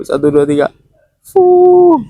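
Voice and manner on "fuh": one hard puff of breath; blowing. Pretend. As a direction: blow out hard like on a candle. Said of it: "Lagi yuk".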